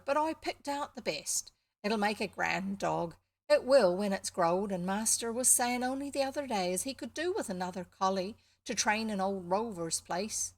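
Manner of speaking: lively Somerset farm lad; slight Somerset accent. Acted. Farm boy recommends the pup he picked, slight Somerset accent. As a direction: boast lightly in a Somerset country accent. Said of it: "I think it's slightly Somerset".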